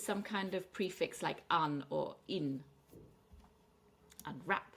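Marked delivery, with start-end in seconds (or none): 4.3-4.7 "Unwrap" with light Un and stressed wrap; second-syllable stress. Shown as a demonstration. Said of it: "stress is on the second syllable".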